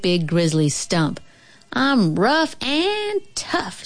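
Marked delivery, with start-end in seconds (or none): 1.7-3.8 high, slow, drawn-out boast; stretched-out childish swagger; bragging. A narrator's reading. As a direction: brag it slowly in a high voice. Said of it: "she bragged".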